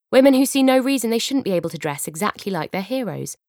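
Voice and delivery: ordinary talk, nothing marked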